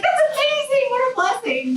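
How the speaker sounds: retelling the valet's reply way up high, bursting with amazement; mock amazed valet. A valet gushing to a mother of eight, mock amazed. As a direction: gush it like a thrilled stranger.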